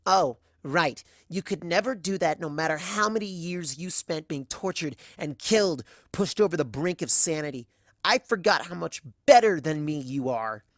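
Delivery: loud, biting sarcastic anger; bitter sarcasm. Acted. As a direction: spit it out, spiteful and loud. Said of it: "I spit the word better".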